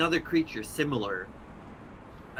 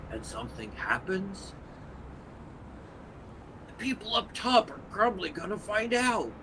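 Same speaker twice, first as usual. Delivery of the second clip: creature voice; 6 semitones higher than usual. Acted. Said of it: "And Rock says".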